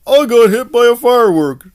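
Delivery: high, loud mock dismay; hammed-up villain reacting to a firework hit. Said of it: "he'll be like".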